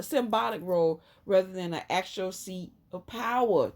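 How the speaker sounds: ordinary talk, nothing marked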